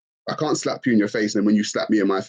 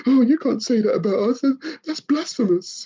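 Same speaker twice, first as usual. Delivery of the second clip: high, whiny mock of an offended believer with a gasp; 13 semitones higher than usual. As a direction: clutch your pearls and whine at being offended. Mocking offended religious critics.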